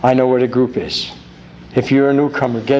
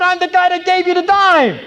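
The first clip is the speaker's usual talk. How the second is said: high, strained holler; yelling from afar. Acted. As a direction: shout it from the back row. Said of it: "yells out".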